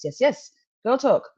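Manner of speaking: ordinary talk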